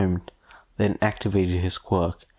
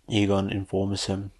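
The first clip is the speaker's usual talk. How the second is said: plain reading voice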